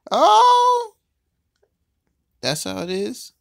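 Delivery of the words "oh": very high, loud oh; shocked shout. Real, not acted. Player just knocked out of the game, loud shocked oh. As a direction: cry out at the sudden loss.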